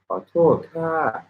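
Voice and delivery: "koto ka" slow, starting high and falling on "ka". Shown as a demonstration. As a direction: slowly drop the pitch on the final "ka". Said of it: "Something like that".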